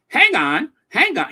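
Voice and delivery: high, loud pompous interruption; mock Piers cutting someone off; pompous "hang on". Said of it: "in that very pompous voice".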